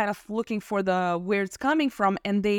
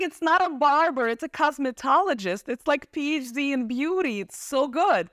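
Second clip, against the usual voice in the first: higher-pitched, enthusiastic self-justification; eager retold defence; 6 semitones higher than usual. Daughter defending beauty school to her parents, eagerly. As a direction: pitch it up, selling the career to doubters.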